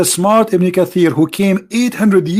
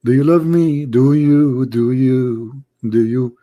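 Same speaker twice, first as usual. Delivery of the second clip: mock clingy message; 3 semitones lower, much slower than usual. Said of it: "They send me always messages saying".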